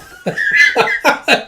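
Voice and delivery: high, loud burst of laughter; sudden amused cackle; real laugh. Real, not acted. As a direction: crack up mid-story. Reader cracks up at line.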